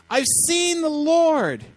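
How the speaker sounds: high, stretched-out joyful cry; excited announcement. Acted. Mary to the disciples with news, exultant cry. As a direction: proclaim it high and slow, thrilled.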